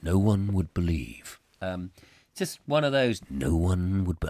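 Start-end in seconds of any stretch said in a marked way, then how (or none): 0.0-1.4 low, portentous album narration; dramatic narrator. Acted. Host quotes [name]'s album, in deep narrator voice. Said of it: "I love doing that". 3.3-4.3 low, portentous album narration; dramatic narrator. Acted. Host quotes [name]'s album, in deep narrator voice. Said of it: "I love doing that".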